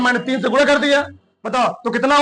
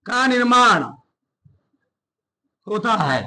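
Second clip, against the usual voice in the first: spaced out, repeating each phrase for writing; teacher reading notes aloud word by word; 4 semitones lower than usual. Shown as a demonstration. Teacher dictating notes to class.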